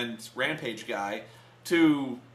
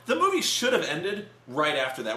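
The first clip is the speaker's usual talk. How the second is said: ordinary talk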